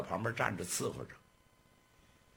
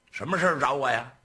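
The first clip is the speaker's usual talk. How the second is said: fast, louder, impatient demand; gang boss; 7 dB louder, much faster than usual. Acted. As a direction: rush him to spit it out.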